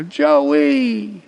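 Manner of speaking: loud high call of the name; hearty drawn-out call. Retold or imitated. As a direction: shout the name across the car. Conductor calls out greeting.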